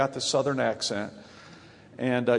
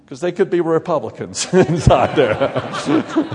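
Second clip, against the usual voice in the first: laughing; 7 dB louder than usual. Real, not acted. Joke to audience, laughing.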